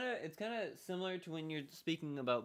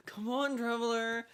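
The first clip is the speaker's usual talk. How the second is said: high, louder mock plea; mock character voice; 11 semitones higher, 6 dB louder than usual. Streamer imitating the posing girl calling traveler, in a high voice. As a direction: playfully voice the impatient girl. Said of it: "she's just like".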